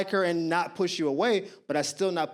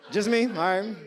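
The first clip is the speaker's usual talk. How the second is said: ordinary delivery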